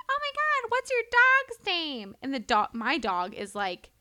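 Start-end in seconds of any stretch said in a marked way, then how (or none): 0.1-2.2 high, slow gushing lady voice; mock Karen voice. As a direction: imitate a nosy neighbour gushing over the dog. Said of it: "and she goes".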